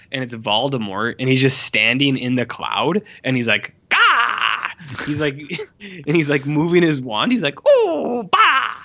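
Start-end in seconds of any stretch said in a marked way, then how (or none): 3.9-4.7 much higher, louder silly "Gah!" cry; mocking a silly movie villain, high goofy yell; mock Voldemort yell. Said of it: "He's, like, making all these sounds". 7.7-8.7 very high squeaky "Ooh, bah!" cracking into a laugh; mocking the villain's sounds, high and laughing; mock villain noises. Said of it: "He's, like, making all these sounds".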